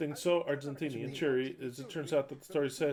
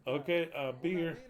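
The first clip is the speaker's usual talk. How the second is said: usual speaking voice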